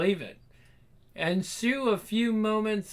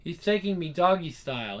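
ordinary delivery

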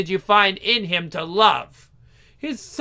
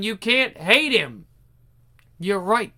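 ordinary delivery

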